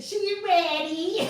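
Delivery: loud, high, stretched comic catchphrase; Tiffany Haddish. Acted. Host possessed by a comedian's spirit, hammed-up impression. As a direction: belt it out slowly like a hyped-up comedian. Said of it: "The spirit of Tiffany Haddish took over me".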